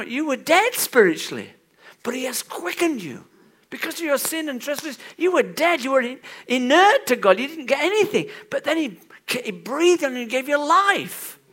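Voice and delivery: loud, high preaching voice; fervent preacher; preaching at full force. Acted. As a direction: drive the point home from the pulpit.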